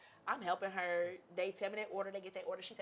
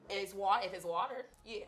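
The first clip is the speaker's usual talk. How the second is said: normal talking voice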